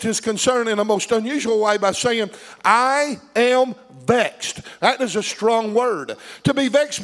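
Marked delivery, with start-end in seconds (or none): 2.6-4.6 slow, weighty quote of a complaint; drawn-out emphasis. Retold or imitated. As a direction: stretch each word out slowly for emphasis. Quotes David's vexation, drawn out.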